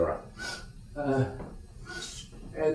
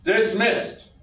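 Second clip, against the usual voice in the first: curt dismissal; 9 semitones higher, 3 dB louder than usual. Acted. Officer dismisses the men.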